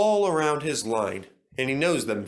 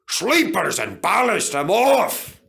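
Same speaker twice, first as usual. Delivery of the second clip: loud, high exclamation; alarmed cry; 8 semitones higher, 6 dB louder than usual. A narrator's reading. Duncan coming off the track, alarmed cry. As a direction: cry out as you derail.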